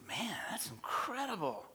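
high, hushed wonder; acted amazement. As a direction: marvel in a high, airy voice. Astonished guy in told joke.

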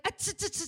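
high, shushing tss sounds to silence someone; hushing tss. Retold or imitated. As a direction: shush him gently, the father cutting him off. Father hushing the son.

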